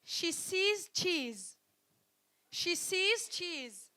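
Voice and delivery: slow, deliberate model; teacher showing the class. Shown as a demonstration. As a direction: stretch each word out. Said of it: "the last tongue twister is".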